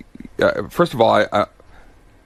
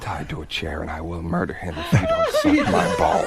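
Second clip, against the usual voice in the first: super spy villain; 2 semitones higher than usual. Pretend. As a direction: play a spy making a ransom call, pitched down. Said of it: "I pretend I'm a super spy".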